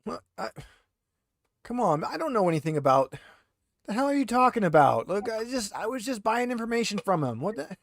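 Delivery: a hint of a rattled, defensive prisoner pleading innocence; lightly voiced handcuffed thug, indignant then wheedling. GM as the interrogated prisoner, lightly voiced and defensive.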